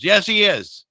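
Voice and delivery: loud, high-pitched comeback; emphatic retort. Acted. Host answering an imagined objector, loudly emphatic. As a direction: answer back with playful force.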